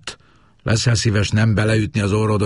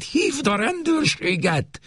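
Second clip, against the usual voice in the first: much higher urgent cry, a little slow; urgent repeat; slower than usual. A narrator's reading. Wife urges daughter to call police, high alarmed cry. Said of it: "ismételte".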